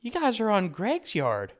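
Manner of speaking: put-on old lady scolding strangers on her yard; quirky old lady. Old lady voiced in story.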